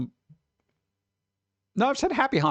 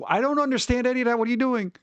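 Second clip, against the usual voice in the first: baffled; 11 semitones higher, faster than usual. Retold or imitated. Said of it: "and I was just like".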